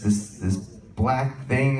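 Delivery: usual speaking voice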